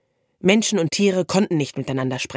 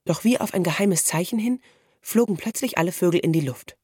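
usual speaking voice

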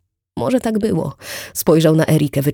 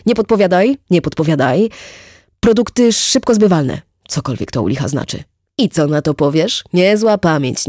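hearty boastful neighbour; 5 dB louder than usual. Acted. Wit to Erika, bragging about his memory, loud hearty chatter. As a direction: show off his memory with gusto.

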